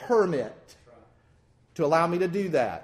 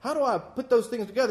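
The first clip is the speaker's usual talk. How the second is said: mock puzzled asking; 6 semitones higher than usual. Quoting a confused believer.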